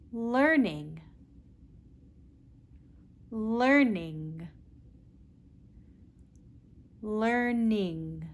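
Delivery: learning, clear L, unhurried; teacher repeating learning; modelled L word. Shown as a demonstration. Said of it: "Practice with me".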